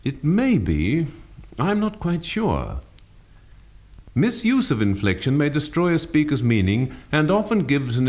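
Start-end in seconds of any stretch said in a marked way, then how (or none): 0.1-2.8 slow, high, wavering up and down; doubtful. Shown as a demonstration. Teacher showing compound inflection, doubtful phrase. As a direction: draw it out, unsure, bending the pitch. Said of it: "up and down or compound inflection on a phrase conveying doubt".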